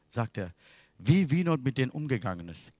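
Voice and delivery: ordinary talk, nothing marked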